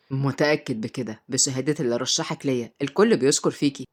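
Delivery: low, measured male character voice; deep-voiced, courteous man; reassuring uncle. A narrator's reading.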